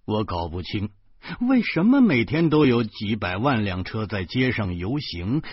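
ordinary delivery, nothing marked

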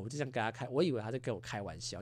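ordinary talk, nothing marked

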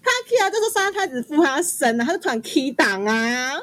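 breathless high-pitched disbelief; excited retelling. Real, not acted. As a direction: tell it like you still can't believe it. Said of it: "我真的被吓到".

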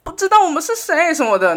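high, loud worried complaint; distraught mom. Retold or imitated. Mom fretting to the nurse about her son, high and loud. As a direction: fret like a panicking mother. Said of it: "然后我妈就说".

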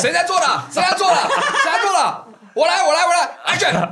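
high excited director calling action; mock film director. Friend playing director on a set, excited. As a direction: call the shot like an eager director, laughing.

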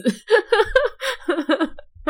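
high, helpless laughing fit; long giggling laugh. Real, not acted. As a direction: crack up at yourself. Laughs after dark joke.

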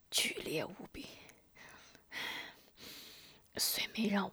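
faint broken whisper with coughs; dying whisper. A narrator's reading. Poisoned man whispering to the healer, dying. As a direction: push each word out with failing breath.

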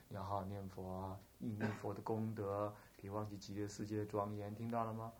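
low, soft, careful urging; gentle exhortation. Acted. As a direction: speak low and softly at a deathbed. Bystanders counselling the dying man. (低声轻柔地叮嘱)

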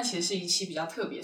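ordinary talk, nothing marked

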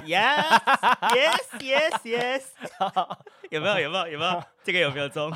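high, laughing yes yes; cheer, delighted; excited cheering. Real, not acted. Cheering correct answer.

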